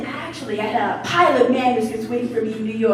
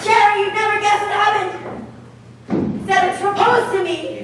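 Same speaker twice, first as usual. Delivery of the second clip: put-on girlish squeals; 7 semitones higher than usual. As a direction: burst out the big news like a schoolgirl. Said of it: "your girlish squeals".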